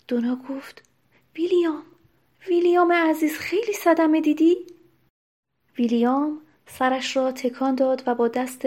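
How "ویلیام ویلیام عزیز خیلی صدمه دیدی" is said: raised pitch, urgent concern; anxious alarm. A narrator's reading. Duna finds dear William wounded, alarmed and pleading. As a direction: call out to him in worried, higher tones.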